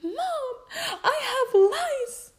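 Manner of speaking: high, loud, drawn-out whine to mom; mock wail. Daughter calling mom about lice, mock tearful wail. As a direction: wail it like a distraught kid, hammed-up. Acts her panicked call to mom.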